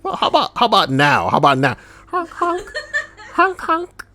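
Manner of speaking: very high, squeaky pleading clown; silly clown. Pretend. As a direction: beg like a goofy clown. Keeps the honking clown going.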